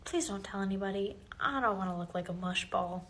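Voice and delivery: low and very soft; hushed, bashful plea; confidential request. Acted. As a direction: ask it quietly, keeping it private. Student asks teacher for secrecy.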